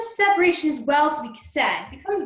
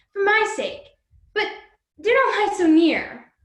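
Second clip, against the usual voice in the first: ordinary delivery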